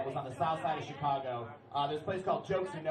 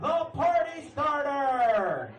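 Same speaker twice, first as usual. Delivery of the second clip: big slow announcer shout, higher; hype-man shout; 8 semitones higher, 6 dB louder, much slower than usual. Pretend. Comic hyping his nickname, announcer shout. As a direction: bellow it like a club MC.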